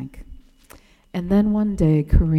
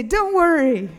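raised, higher fond brush-off; Kareem waving off payment; 9 semitones higher than usual. Retold or imitated. Attendant refusing payment from the narrator, raised fond voice. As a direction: refuse the money gently, pitch lifted. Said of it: "and he'd say".

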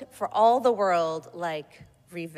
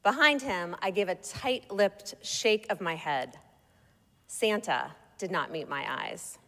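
ordinary delivery, nothing marked

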